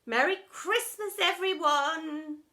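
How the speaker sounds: high, loud cheery call; shout over the din. A narrator's reading. Headmistress calling over the din, loud and high. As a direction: call out over a noisy hall. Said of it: "shouted Miss Stint over the din".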